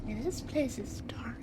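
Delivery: hushed whisper in the dark. Acted. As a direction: breathe it out, barely voiced. Dark scary place exploring.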